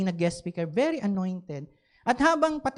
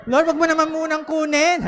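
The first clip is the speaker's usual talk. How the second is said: laughing plea; 7 semitones higher, 5 dB louder than usual. Retold or imitated. Begging God to spare iPad.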